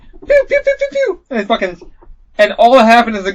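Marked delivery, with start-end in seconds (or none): 0.0-1.2 very high pew pew sounds; tiny gunshot sound effects; gun noises. Retold or imitated. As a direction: imitate firing guns, squeaky. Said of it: "and I'm like".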